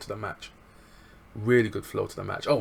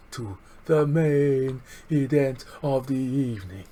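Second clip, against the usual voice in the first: higher, louder, slow ring announcer build; 4 semitones higher, 6 dB louder, slower than usual. Acted. Vlogger introducing the main event like a ring announcer. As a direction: boom it out like the main event call.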